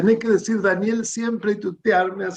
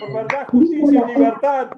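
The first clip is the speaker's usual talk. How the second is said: raised rallying slogan; booming balcony speech; 6 semitones higher, 4 dB louder than usual. Real, not acted. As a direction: proclaim it like a motto. Patriotic cheers at farewell. (consigna proclamada en voz alta)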